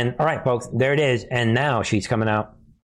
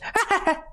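high laugh. Real, not acted. Laughing at own impression.

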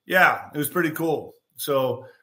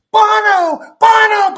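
shrieked far higher and much louder, like a crowd of fans; screaming fans; 11 dB louder, slower than usual. Retold or imitated. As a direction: scream his name like a starstruck fan. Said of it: "the whole church went ballistic".

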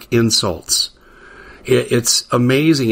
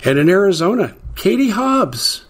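Valley girl imitation; 9 semitones higher than usual. Host mocking Katie Hobbs to listeners, high Valley girl mimicry. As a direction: lift into a teenage Valley girl voice to mock her. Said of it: "She talks like a nineties Valley girl".

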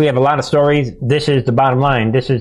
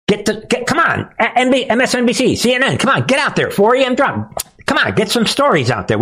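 high, taunting mock of the media; mocking villain voice; 11 semitones higher, faster than usual. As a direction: mimic the scheming news bosses. Mocks media as globalist puppets.

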